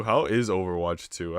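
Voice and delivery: normal talking voice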